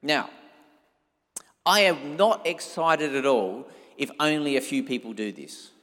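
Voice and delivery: ordinary talk, nothing marked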